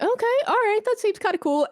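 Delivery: a lightly acted eager okay; excited self-quote. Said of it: "so I was like".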